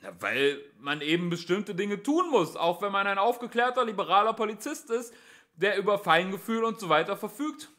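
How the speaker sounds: high, loud self-righteous explanation; policeman's earnest holler; shouted justification. A narrator's reading. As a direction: explain yourself across a distance.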